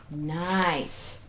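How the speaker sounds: ordinary talk, nothing marked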